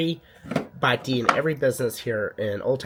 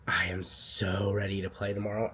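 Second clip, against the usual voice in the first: whispering; 5 semitones lower, 7 dB quieter than usual. Real, not acted. Excited whisper to camera.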